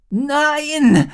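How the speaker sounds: very high, loud scream of no; girl screaming as the enemy escapes. A narrator's reading. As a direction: cry out in despair. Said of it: "schrie Rory".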